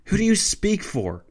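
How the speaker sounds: high-pitched outburst, voice jumping up; outraged shriek. A narrator's reading. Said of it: "he shrieked".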